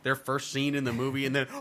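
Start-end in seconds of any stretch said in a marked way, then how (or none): 1.5-1.6 mock fan cheer. Fans as the hero appears on screen, mock cheering. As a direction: imitate a crowd losing it with a drawn-out cheer. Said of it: "people are like".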